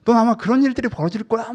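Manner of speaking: loud, high-pitched badgering as fear personified; yelling fear. Personified fear turns on its owner, yelling threats. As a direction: throw loud, taunting questions at the listener. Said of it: "막 이렇게 애가 막 엄청 소리를 질러요".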